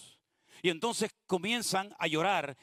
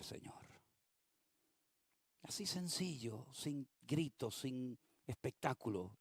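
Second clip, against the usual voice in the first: soft, low whispered-toward prayer; hushed prayer; 6 semitones lower, 8 dB quieter than usual. Retold or imitated. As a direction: pray it quietly, pleading. Prays aloud for healing.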